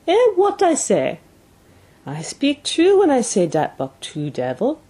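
put-on broken English in a thick French-Canadian accent; vindicated French-Canadian "told you so"; French-Canadian dog driver. A narrator's reading. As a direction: open high on an exclamation, French-Canadian accent. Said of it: "This was Francois's speech".

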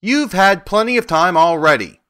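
angry. A narrator's reading. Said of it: "said the Tin Woodman angrily".

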